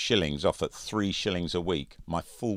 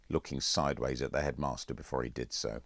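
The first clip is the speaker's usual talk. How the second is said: usual speaking voice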